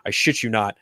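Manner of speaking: usual speaking voice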